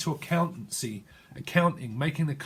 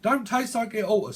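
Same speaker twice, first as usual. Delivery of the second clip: loose Cockney with dropped t's; hammed-up London vernacular; improper Cockney; 5 semitones higher than usual. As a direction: play up a Cockney glottal stop. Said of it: "then someone doesn't".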